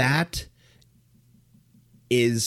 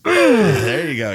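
spit take; 6 semitones higher, 8 dB louder, slower than usual. Real, not acted. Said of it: "I got to do a spit take".

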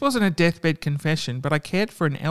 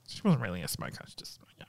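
whispered aside; 9 semitones lower, 12 dB quieter, faster than usual. Real, not acted.

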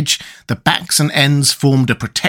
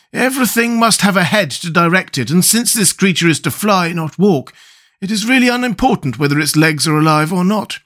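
thoughtful suggestion, pitched up; lightly voiced Scarecrow; 6 semitones higher than usual. A narrator's reading. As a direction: offer an idea in a raised character voice.

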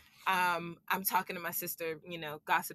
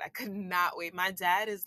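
normal talking voice

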